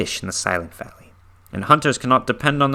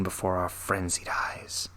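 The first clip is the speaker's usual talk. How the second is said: hushed close; 2 semitones lower, 12 dB quieter than usual. A narrator's reading. Ending a horror passage.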